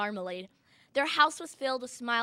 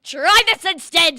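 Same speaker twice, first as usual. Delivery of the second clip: very loud, high retort; yelled back; 5 semitones higher, 12 dB louder than usual. A narrator's reading. Prince answering the second sister with his sword, yelled back. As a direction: yell straight back at her. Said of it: "the prince yelled back".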